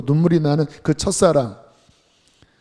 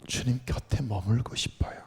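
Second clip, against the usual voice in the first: much softer, hushed longing; soft prayer; 4 semitones lower, 9 dB quieter than usual. Retold or imitated. Brother begs to stay near the Lord, hushed. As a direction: breathe the words quietly. Quoting a dying man's prayer.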